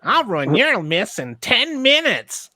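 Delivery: mocking high voice. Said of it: "mocking the tweet".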